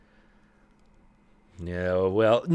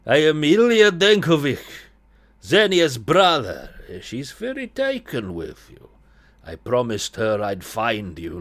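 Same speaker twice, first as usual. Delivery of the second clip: put-on Eastern European accent; 2 semitones higher, 5 dB louder than usual. Brother introduces himself to the artist, Eastern European accent. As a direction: announce yourself in a loose Eastern European accent. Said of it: "I don't know what accent I'm doing here".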